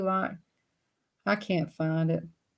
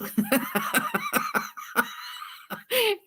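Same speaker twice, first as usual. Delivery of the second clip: squealing high laugh; high puppet cackle. Acted.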